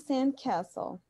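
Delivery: slow American "sand castle", short flat A as in cat; American pronunciation. Shown as a demonstration. Teacher contrasting it with British for students, American pronunciation. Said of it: "Em inglês americano, nós temos".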